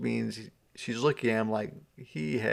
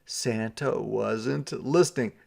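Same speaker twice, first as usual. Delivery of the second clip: usual speaking voice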